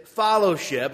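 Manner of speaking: FELLOWSHIP with an "ah" in the first syllable, "FOLLOW-ship"; said as followship. Shown as a demonstration. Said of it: "when I said".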